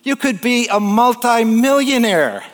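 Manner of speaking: high, ringing sales climax; excited climax. Retold or imitated. Harvard grad promising a fisherman riches, thrilled. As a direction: top off the pitch with a big flourish.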